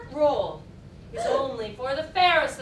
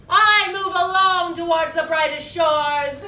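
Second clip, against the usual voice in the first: loud, soaring proclamation; grand declamation; 4 semitones higher, 11 dB louder than usual. Acted. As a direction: announce it to the heavens. Grand declamation of her destiny.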